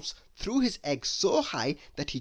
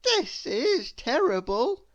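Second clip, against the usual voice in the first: put-on upset little girl; 10 semitones higher, 4 dB louder, slower than usual. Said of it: "cried little Miss Dotty".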